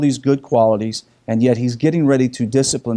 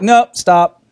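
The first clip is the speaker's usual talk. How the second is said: higher, louder firm cut-off; father halting a moody daughter, firm; firm stop; 6 semitones higher, 6 dB louder than usual. Retold or imitated. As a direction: shut the mope down firmly. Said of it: "I was like".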